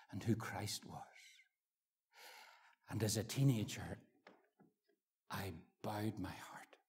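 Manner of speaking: unvoiced hushed memory; whisper. Preacher recalling his teenage conversion in a whisper.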